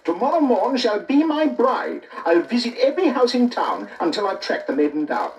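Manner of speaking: the prince vows to search the whole town; proclaiming prince. A narrator's reading. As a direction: declare it grandly to the court. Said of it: "he cried".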